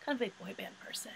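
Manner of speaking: a whispered confession. Real, not acted. Admitting boy band love in a whisper. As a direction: confide it in a whisper.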